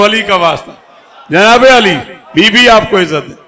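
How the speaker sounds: loud playful coaxing of the crowd; calling out to crowd. Real, not acted. Preacher loudly coaxing a shy boy in the crowd to call out. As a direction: egg the listeners on to shout back.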